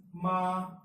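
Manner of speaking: ma held long on a level pitch; first tone. Shown as a demonstration. Teacher demonstrating tones to students, flat first tone. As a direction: sustain ma evenly without rise or fall. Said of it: "ma in first tone".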